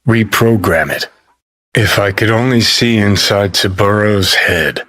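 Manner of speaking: ordinary talk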